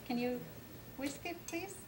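ordinary delivery

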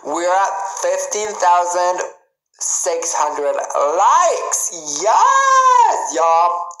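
drawn-out count rising to a high squeal; triumphant, climbing cheer; excited announcement. Real, not acted. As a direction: build slowly to a shrieked yes. Celebrating the count of likes.

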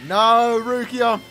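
usual speaking voice

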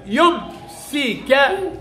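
يمسكه drawn out very slowly, with a damma on the kaf and the final ha sounded; slow demo of the ending. Shown as a demonstration. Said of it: "ك-، ضمه". (يمسكه ببطء مع ضم الكاف)

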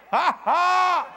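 loud, deliberate "ha ha"; demonstrated laugh. Shown as a demonstration. Preacher showing a mouth filled with laughter, loud staged laugh. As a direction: belt out a big mock laugh. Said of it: "Then was our mouth filled with laughter".

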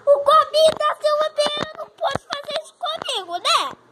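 shouting at the cat in frustration; loud, fed-up scolding; exasperated shout. Real, not acted.

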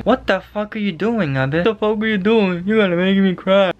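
high, slow, exaggerated plea; mock-despairing wail. Citizen pleading with the prime minister over masks, mock despair. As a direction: plead like you're about to cry, hammed up. Mock wailing at Abe's decision.

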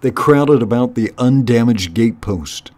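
ordinary delivery, nothing marked

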